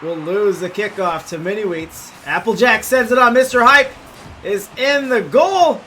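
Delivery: fast, rising sports commentary; live play-by-play. Acted. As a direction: call the game as it happens.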